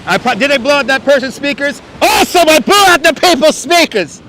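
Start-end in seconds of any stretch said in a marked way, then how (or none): 2.0-4.2 gleeful shout. Real, not acted. Delighted at blowing out speakers.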